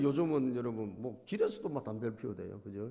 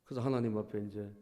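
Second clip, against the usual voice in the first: ordinary talk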